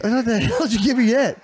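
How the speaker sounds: high, alarmed question; shocked and demanding. Retold or imitated. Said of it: "retells drugged panic".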